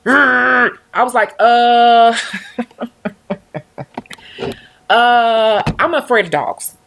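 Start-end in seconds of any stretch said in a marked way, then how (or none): 0.1-0.8 loud mock snarl; dog snarl imitation. Vlogger imitating a dog lunging at her, loud snarl. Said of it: "jumping forward". 1.4-6.8 nervous admission. Retold or imitated. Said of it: "that's what I said".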